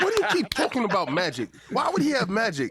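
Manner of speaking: mock ranting. Retells viewer rant, laughing.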